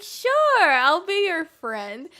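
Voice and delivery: very high, loud, slow sing-song; sugary voice that hides a trick; mock sweet mean girl. The mean girl fake-accepting friendship, sugary high sing-song.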